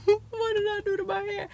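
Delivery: high mock dismay; regretful. Vlogger acting out dismay at shrinkage, high mock dismay. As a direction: cry out over your own hair. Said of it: "and I'll be like".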